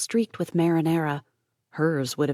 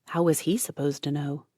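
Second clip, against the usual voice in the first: usual speaking voice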